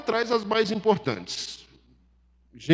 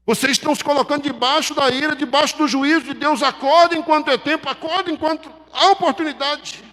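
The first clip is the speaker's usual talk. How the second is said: high-pitched, pressing wake-up call; God's urgent warning; 8 semitones higher, 4 dB louder than usual. Acted. God warning the people before judgment falls, urgent high-pitched appeal. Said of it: "Deus tá dizendo".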